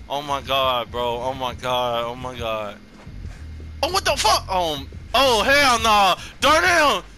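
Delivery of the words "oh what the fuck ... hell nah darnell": much higher, louder cries; high startled yelling; alarmed yell. Real, not acted. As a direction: shout a teammate's name in alarm. Teammate caught again.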